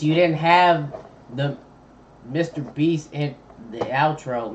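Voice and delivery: normal talking voice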